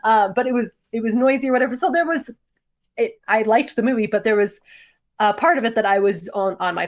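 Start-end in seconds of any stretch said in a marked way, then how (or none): none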